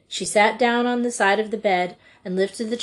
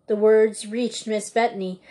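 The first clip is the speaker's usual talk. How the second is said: usual speaking voice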